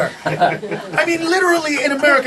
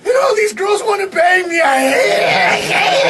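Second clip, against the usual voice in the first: mock wailing complaint; 6 semitones higher than usual. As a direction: bawl in hammed-up despair over good fortune. Mocking spoiled Americans complaining of abundance.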